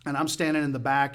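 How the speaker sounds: ordinary talk, nothing marked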